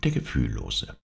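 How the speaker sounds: usual speaking voice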